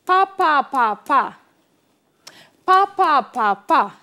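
pa pa pa pa spoken as a beat; rhythm syllables. Shown as a demonstration. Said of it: "So we have that twice".

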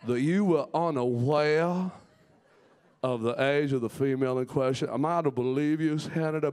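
Southern senator. Acted. Senator grilling another about the female in question, Southern accent. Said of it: "Southern to me is like a senator".

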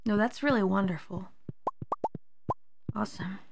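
flat fake praise, dropping away; sarcastic. Real, not acted. Sarcastic praise after failure.

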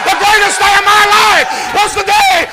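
high, loud preaching building to a sustained shout; full-force preaching. Real, not acted. Preacher testifies to being pulled from the pit, building to shouting.

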